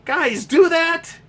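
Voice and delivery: imitating stunned bachelors; mock disbelief. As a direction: gasp it out like a shocked single guy. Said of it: "Single guys are like".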